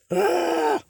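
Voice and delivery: short high, loud scream; exasperated scream. Acted. Viewer fed up with a film character, exasperated scream. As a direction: let out a frustrated high yell.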